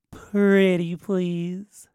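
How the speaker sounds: coaxing, very slow plea; drawn-out pleading. Acted. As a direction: stretch both words out very slowly. Acted pleading request.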